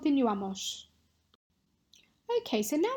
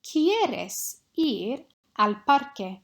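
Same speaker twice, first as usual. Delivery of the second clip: ¿Quieres ir al parque? read slowly and clearly, a bit louder; slow dictation; 4 dB louder, much slower than usual. Shown as a demonstration. Teacher dictates a sentence to students, slow and clear. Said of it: "say one of these six sentences".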